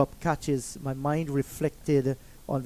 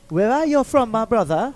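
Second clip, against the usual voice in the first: a higher, hammed-up Jamaican accent; teasing Jamaican greeting, pitched up; 6 semitones higher, 4 dB louder than usual. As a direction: playfully mimic the old Jamaican coach's accent. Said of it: "he's like".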